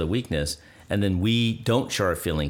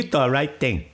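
put-on Chinese accent; 4 semitones higher than usual. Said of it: "It's okay to do a Chinese accent".

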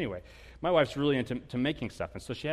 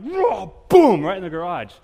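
much louder and higher, a hammer-swing shout; frustrated yell; 5 semitones higher, 14 dB louder than usual. Retold or imitated. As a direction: yell as you slam the hammer down. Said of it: "getting mad and frustrated".

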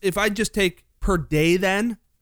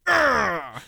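frustrated groan; 6 semitones higher than usual. Acted. Mock frustration at wall joke.

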